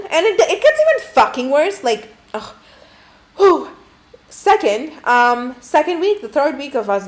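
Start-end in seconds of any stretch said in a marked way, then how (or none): 3.4-3.7 high, loud whew; exasperated whew. Real, not acted. Bracing to tell the worse part, exasperated whew. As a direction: blow out a big exasperated breath.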